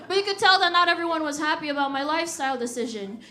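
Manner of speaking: ordinary delivery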